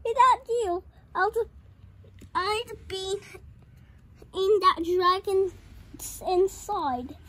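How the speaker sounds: a touch of gushing gratitude; grateful witch. A narrator's reading. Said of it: "the grateful witch cried".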